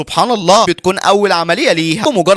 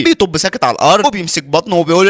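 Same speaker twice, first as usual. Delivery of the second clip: usual speaking voice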